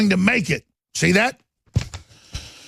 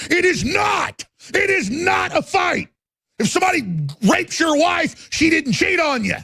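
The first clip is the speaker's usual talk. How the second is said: furious, much higher shouting; real angry shout; 10 semitones higher than usual. Real, not acted. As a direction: yell it out in real outrage. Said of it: "Gosh, I'm getting angry".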